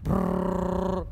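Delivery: long rolled trill on a low note; imitated whirring noise. Shown as a demonstration. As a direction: make a drawn-out motor-like brrr. Imitates whirring of a spinning thing.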